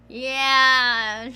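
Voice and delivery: ordinary delivery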